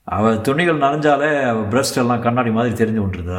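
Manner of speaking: usual speaking voice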